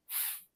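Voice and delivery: f as a soft hiss of air through lip and teeth, with no vowel; bare f sound. Shown as a demonstration. Teacher showing learners the bare f of five. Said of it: "fo is actually pronounced as".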